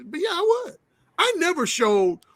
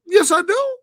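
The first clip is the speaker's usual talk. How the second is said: higher-pitched imitation of an earnest woman; serious insistence; 10 semitones higher, 6 dB louder than usual. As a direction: insist firmly, quoting her in a raised pitch. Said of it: "she got real serious".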